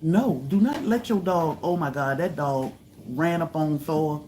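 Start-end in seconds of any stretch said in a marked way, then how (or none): none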